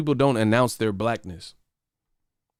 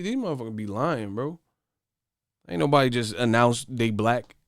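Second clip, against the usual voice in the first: ordinary talk, nothing marked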